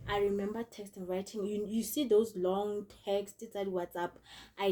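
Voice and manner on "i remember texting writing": usual speaking voice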